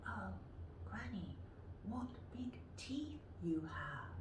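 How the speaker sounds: put-on hushed breathy girl; whispered. Shown as a demonstration. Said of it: "practice them yourself".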